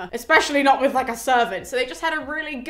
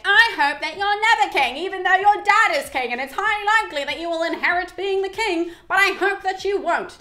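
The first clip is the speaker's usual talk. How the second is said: higher and louder, a whiny bratty suitor; childish taunt; 7 semitones higher, 5 dB louder than usual. Retold or imitated. Suitor mocking Telemachus's claim to the throne, bratty and whiny. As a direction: taunt him like a spoilt kid. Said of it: "being childish and saying".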